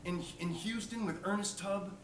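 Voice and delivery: a hint of an enthusiastic old-timer; lightly voiced old man. Old man praises the singer's song, lightly voiced and gushing. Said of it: "He goes".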